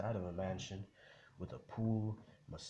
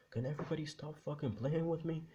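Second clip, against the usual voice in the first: high-pitched fed-up girl, acted out; mock exasperation; 8 semitones higher than usual. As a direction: play her exasperated, pitched well up. Exasperated girl in retold story.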